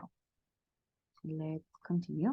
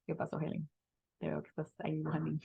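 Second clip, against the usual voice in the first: whisper; 2 semitones lower, 8 dB quieter than usual. Real, not acted.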